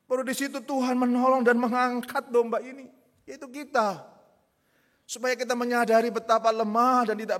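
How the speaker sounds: loud, high preaching build; fervent preaching. Acted. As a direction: drive the point home to the congregation. Preacher at full force.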